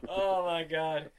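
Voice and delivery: usual speaking voice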